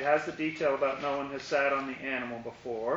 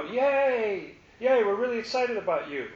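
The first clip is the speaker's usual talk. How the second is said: mock crowd cheer; 5 semitones higher than usual. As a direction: hail him like a fan in the crowd. Crowd cheering Jesus' entry.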